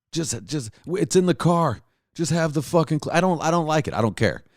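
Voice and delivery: high whiny refusal, a hammed-up girlfriend voice; mock whiny girlfriend. Girlfriend refusing the Clif Bar in the car, whiny high voice.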